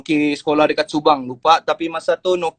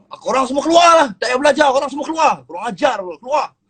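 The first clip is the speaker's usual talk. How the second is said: angry teacher shouting; 7 semitones higher, faster than usual. Retold or imitated. Furious teacher shouting at his own class, mock rage. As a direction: blow up at your students and throw them out. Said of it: "Terus dia jerit dekat kelas dia".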